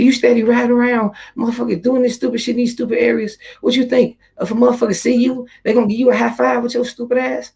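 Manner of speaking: put-on girlfriend's voice, high and scolding. Girlfriend scolds him about stupid areas, high mocking scold. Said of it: "she said".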